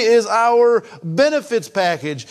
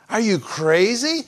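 ordinary delivery

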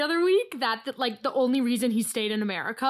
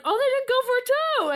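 high-pitched realisation; acted-out thought, pitched way up; retold surprise; 12 semitones higher, 4 dB louder than usual. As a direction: voice a sudden relieved discovery. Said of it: "I was like".

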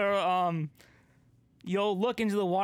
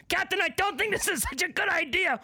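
high, put-on sailor banter; skipper and captain voices; 8 semitones higher than usual. Skipper warns captain, captain brushes it off, both high. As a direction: trade worried and breezy lines high. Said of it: "then Skipper's like".

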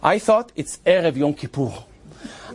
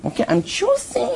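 imitating woman; 6 semitones higher, slower than usual. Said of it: "And she said".

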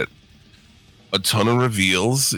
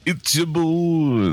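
announcer voice; 9 semitones higher, much slower than usual. Acted. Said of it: "show intro announcer voice".

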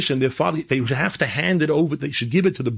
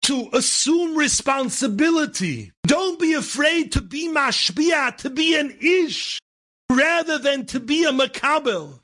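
slow, high preaching cry; sustained high-pitched sermon peak; impassioned exhortation; 12 semitones higher, much slower than usual. Real, not acted. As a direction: urge the congregation on, stretching every phrase.

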